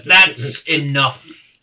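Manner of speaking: loud, slow, drawn-out order; stern voice of authority, stretched out; commanding interruption. Acted. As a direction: boom it slowly to stop a fight. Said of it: "you all hear a voice that says".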